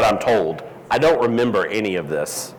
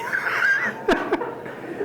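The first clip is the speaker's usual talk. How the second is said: breathy high laugh; 7 dB quieter than usual. Real, not acted. Laughing at mushroom reaction.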